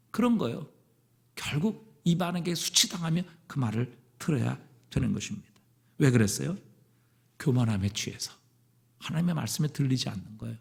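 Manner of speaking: ordinary talk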